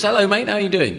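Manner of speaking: talking dog. Acted. Dog hails a passing man in the shop, lightly voiced chummy talking dog. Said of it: "the dog says".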